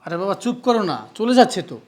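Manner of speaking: loud exasperated shout. Acted. Husband shouts at his wife to stop while his sister leaves, loud exasperated shout. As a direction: yell at her to stop.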